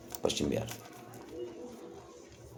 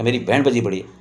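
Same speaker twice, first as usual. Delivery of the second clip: louder, higher mock scolding of a friend; scolding a colleague; 4 semitones higher, 8 dB louder than usual. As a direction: tell Rajeev off, raised and impatient. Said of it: "मैंने हाथी को डांटा". (डांटते हुए, ऊँची तेज़ आवाज़ में)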